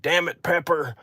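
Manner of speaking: high-pitched mock cowboy exclamation; movie cowboy quote. Quoting the cowboy from the movie, high mock voice. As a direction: toss off the line like an exasperated movie cowboy. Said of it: "He's like".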